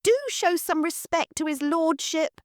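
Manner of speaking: plain reading voice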